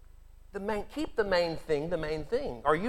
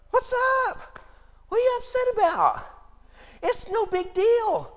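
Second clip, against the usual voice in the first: Jesus gently teasing the upset sisters, light and high; unbothered Jesus; 5 dB louder than usual. Acted. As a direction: shrug it off, no hurry at all. Jesus calmly voiced to upset sisters.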